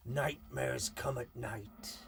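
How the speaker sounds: slow, drawn-out West Country yokel voice; hammed-up rural yokel drawl; mock farmyard accent. Shown as a demonstration. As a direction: read the title like a farmer from the sticks. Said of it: "in a Farmyard accent".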